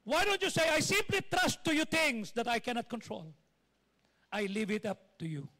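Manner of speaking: loud high preacher's exhortation; preaching at full force. Acted. Pastor urging the congregation, loud fervent preaching. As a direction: preach it out to the crowd with fire.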